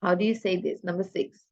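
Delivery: normal talking voice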